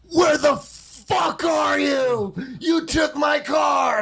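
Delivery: high, slow, drawn-out mock shout; mock furious yell. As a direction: imitate a livid friend yelling down the phone, every word stretched. Angry friend on phone, acted out.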